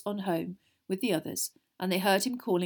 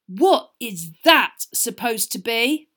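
slow, loud, emphatic disgust; 3 semitones higher, 10 dB louder, slower than usual. A narrator's reading. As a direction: drag out the question in revulsion. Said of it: "made a loud noise of disgust".

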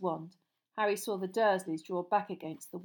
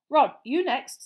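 loud call; 6 semitones higher, 12 dB louder, slower than usual. Acted. Father directing son.

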